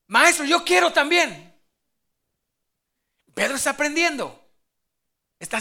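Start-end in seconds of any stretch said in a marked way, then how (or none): 0.0-1.5 louder, higher eager outburst; eager Peter. Acted. Peter asking Jesus on the water, eager call. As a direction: call it out like a keen pupil. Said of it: "y qué le dice".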